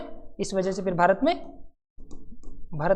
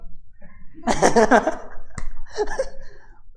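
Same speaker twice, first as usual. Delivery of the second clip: breathy mocking laugh; 4 semitones higher than usual. Courtiers laughing.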